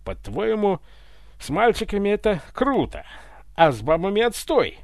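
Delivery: high, loud outraged challenge; indignant retort. Acted. Ivan argues back at the tsar, indignant. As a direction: throw his logic back at him.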